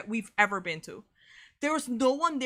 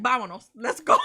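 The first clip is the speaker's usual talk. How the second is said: laughing; 9 dB louder than usual. Real, not acted. Dad leaving, laughing retelling.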